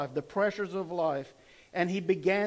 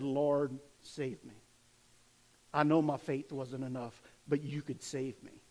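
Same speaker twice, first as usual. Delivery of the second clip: soft plea; 6 semitones lower, 6 dB quieter than usual. Retold or imitated. Sinking Peter humbly begs the Lord, hushed and low. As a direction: lower and much softer, a humble prayer.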